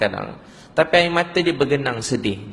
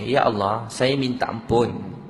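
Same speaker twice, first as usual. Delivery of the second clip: slow, lower, measured prayer; leading a repentance prayer; 4 semitones lower, slower than usual. Retold or imitated. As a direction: lead them phrase by phrase, slowly. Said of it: "cuba ikut saya".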